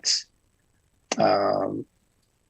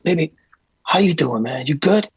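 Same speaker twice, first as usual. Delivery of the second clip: higher, louder, upbeat imitation of a star; 6 semitones higher, 3 dB louder than usual. Imitating a hyped star greeting a crew member, higher and louder. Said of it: "puts his hand on my shoulder".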